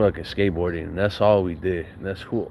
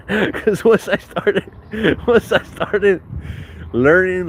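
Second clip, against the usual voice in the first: laughing; 9 semitones higher, 3 dB louder, slower than usual. Real, not acted. Laughing through the story.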